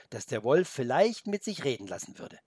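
ordinary delivery

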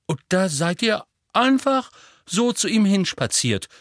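breathless. Acted. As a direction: gasp out the question. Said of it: "sagte Hermine atemlos". (atemlos, hoch und lauter)

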